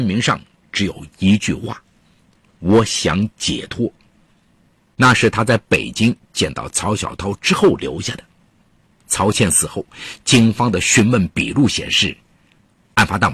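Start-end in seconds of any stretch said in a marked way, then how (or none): none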